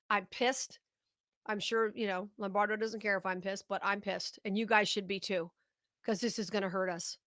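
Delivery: ordinary delivery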